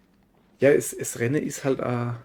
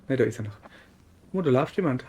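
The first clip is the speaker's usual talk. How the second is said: normal talking voice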